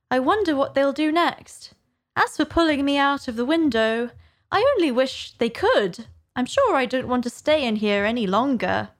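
Alice wondering. Acted. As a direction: think aloud as young Alice.

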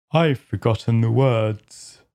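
low, gruff creature voice; a rumbling Griffin. Acted. Griffin starts explaining the dance, low and gruff.

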